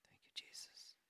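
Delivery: breathy whispered prayer under the breath; whispered thanks. Real, not acted. Pastor pausing mid-reading to thank Jesus, whispered. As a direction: whisper it to God, barely audible.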